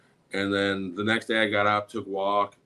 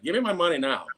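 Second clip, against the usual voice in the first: raised pitch, insistent demand; flustered demand; 6 semitones higher, faster than usual. Retold or imitated. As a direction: retell it a little flustered, pressing for payment. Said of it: "started getting a little flustered".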